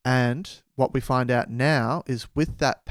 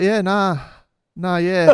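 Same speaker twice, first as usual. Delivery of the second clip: Australian yeah nah; 7 semitones higher, 7 dB louder than usual. Retold or imitated. Ian answering the travellers in a loud, broad Australian accent. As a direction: toss it off like a laid-back Aussie. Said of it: "The most Australian thing you could possibly say".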